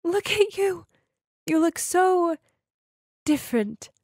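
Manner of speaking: high, halting voice of an overcome mother; tearful blurting. A narrator's reading. Mom blurting to her daughter at the airport, acted tearful. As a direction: choke it out in pieces, starting high. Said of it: "Through sobs, she blurted out".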